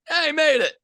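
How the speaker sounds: high, loud burst of triumph; triumphant cheer. Real, not acted. Celebrating his golfer making the cut, loud high cheer. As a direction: crow it out like you just won.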